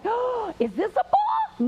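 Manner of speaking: high, drawn-out, gushing question; excited teacher guess. Retold or imitated. Teacher guessing what Billy's gift is, excited. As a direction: gush with exaggerated wonder, slowly.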